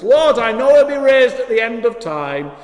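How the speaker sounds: high, loud acted insistence; loud protesting declaration. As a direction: declare it emphatically as Martha to Jesus. Acts out Martha answering the Lord.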